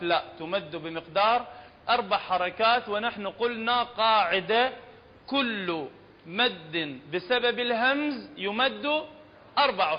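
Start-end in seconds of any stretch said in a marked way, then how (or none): none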